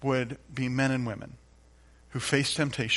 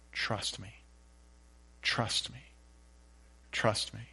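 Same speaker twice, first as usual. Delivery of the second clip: gentle voice of Jesus; 7 dB quieter, slower than usual. Retold or imitated. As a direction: hush it tenderly like a reassurance. Voicing Jesus softly.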